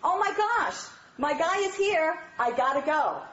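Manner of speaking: wife thrilled her husband is home; high happy hurry; delighted. Shown as a demonstration. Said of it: "And you should say".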